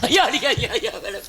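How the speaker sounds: high, hammed-up yelps for mercy; mock pleading that drops away in volume; beaten man pleading. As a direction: act out a comic wail under police blows. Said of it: "காவல்துறையில அடி வாங்க பாத்திருக்கீங்களா".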